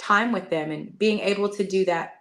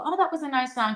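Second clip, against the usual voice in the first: mild shrug; 6 semitones higher than usual. Retold or imitated. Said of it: "when people go".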